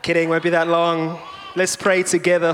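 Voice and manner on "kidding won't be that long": usual speaking voice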